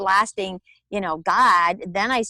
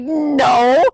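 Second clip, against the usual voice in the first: loud, higher mock yell; retold defiant yell; 5 semitones higher, 10 dB louder than usual. Woman answering the inner voice, yelling defiantly. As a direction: yell your refusal, reliving it. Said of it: "So I started yelling again".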